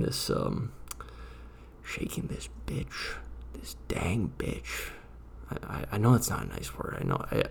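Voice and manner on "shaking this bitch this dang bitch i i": slow hissed whisper. Pretend. Cursing the witch, slow whisper. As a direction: breathe the insult slowly. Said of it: "I can say this in normal tone".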